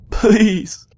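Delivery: high, loud, pleading; one last begging cry; desperate cry. Acted. Celestia facing her monstrous sister, high desperate plea.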